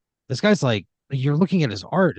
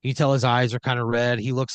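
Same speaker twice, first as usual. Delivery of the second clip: ordinary talk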